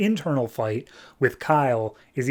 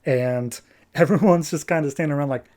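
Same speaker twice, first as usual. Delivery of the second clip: ordinary talk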